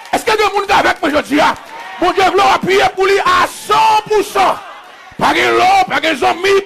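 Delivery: loud, high-pitched exhortation that keeps climbing; full-force preaching. Real, not acted. Preacher urging the congregation to lean wholly on God, full-force preaching. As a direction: shout it out to rouse the congregation.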